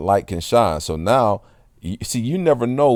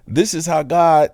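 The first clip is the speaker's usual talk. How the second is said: lightly voiced lady starting to explain; lady's voice; 9 semitones higher than usual. Quoting the lady.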